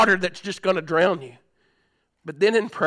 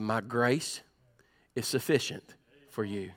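hushed whisper of God's reassurance; 10 semitones lower, 12 dB quieter, slower than usual. Acted. God whispering comfort to Paul in prayer. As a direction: breathe it as a soft secret.

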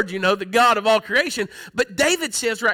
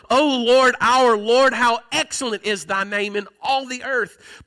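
slow opening cry of praise, ringing out; fervent, not monotone. Shown as a demonstration. Preacher shows how David said the psalm, fervent and emphatic. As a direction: proclaim it emphatically with long-held words. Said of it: "He didn't say that in a monotone way".

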